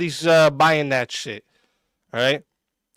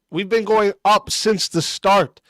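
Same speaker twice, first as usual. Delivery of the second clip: usual speaking voice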